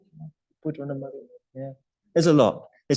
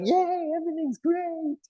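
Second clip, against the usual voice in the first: much higher and slow, blissfully happy dog; cheerful dog voice; 3 dB quieter than usual. Acted. Teacher voices a carefree three-legged dog, much higher and slow. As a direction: sing it out like a three-legged dog who doesn't care. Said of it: "They're like".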